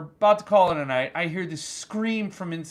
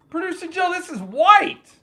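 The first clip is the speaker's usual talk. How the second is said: high-pitched disbelief, punching the last word; astonished complaint; 12 semitones higher, 3 dB louder than usual. Real, not acted. Host tells his producer about the drink, high and astonished. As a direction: call out in comic dismay.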